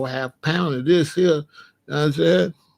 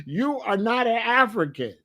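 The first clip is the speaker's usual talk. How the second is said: put-on higher, a scoffing kid's voice; mock kid calling out the newcomer; 7 semitones higher than usual. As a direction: laugh him out of the room as a kid. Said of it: "These kids is gonna be like".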